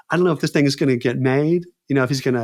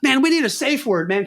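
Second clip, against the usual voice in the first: high, urgent plea for a safe word; panicked actor proposing a safe word; frightened plea; 8 semitones higher than usual. Retold or imitated. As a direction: beg him to stop, scared. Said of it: "I'm getting scared now".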